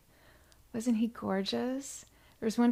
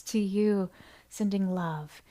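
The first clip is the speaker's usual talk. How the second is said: usual speaking voice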